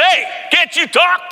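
slow, high-pitched shout as the father; acting out dad yelling; imitated yell. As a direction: bellow it like an impatient dad. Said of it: "yelled at me".

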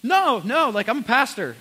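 loud, insistent no no; emphatic correction. Retold or imitated. Pastor correcting the waitress, loud insistence. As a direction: correct her firmly and loud. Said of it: "I was like".

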